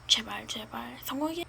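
whispered hopeful plea; whispering. Acted. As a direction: breathe it out anxiously. Anxious hoping for success.

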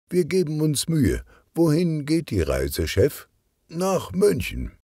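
high-pitched, lightly voiced characters; lively story dialogue. A narrator's reading. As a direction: lift the voice well up for quick back-and-forth. Dialogue between assistant and boss.

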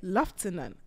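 Lieutenant said lef-TEN-ant, slow and low; teasing British Lieutenant, lef-ten-ant. Shown as a demonstration. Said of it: "Y'all heard a new word today?".

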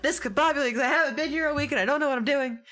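high, rushed, flustered babble; gasp, then rattle it out half laughing; hammed-up discombobulated panic; acted fluster. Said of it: "I come in and I'm like".

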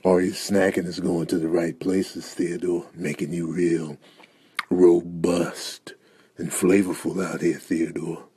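low, hushed, slow purr; creepy seduction. Acted. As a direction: savour each phrase with hungry longing. Seductive aside to Theodore.

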